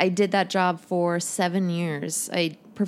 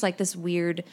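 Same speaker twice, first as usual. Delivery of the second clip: ordinary talk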